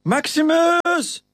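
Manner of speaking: very high, slow drawn-out yell of a name; desperate scream. A narrator's reading. Titus screams for his friend Maximus amid the rats, long high desperate yell. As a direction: scream for your friend across the tunnel. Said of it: "te schreeuwen".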